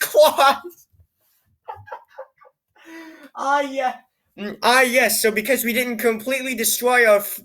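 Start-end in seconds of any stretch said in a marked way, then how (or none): none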